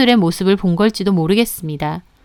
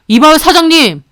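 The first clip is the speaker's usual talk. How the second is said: loud, high confrontation; angry call; 5 semitones higher, 5 dB louder than usual. Acted. Husband confronting the boss, angry.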